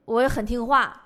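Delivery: normal talking voice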